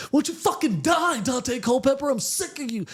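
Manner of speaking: put-on high, loud furious kid yell; kid raging at a video game. Kid losing at Madden yells at the quarterback in fury. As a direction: scream at the game like a sore loser. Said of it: "blaming it all on Dante".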